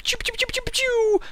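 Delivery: high, rapid pew-pew noises; laser sound effect. Shown as a demonstration. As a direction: imitate a laser gun firing. Imitates firing laser beams.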